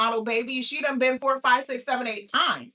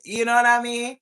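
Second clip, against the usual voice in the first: usual speaking voice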